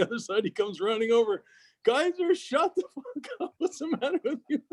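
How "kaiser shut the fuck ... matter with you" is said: much higher, laughing mock scold; imitating the lawyer scolding. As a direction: squeak it out like the friend telling him off, half laughing. Friend runs over scolding him.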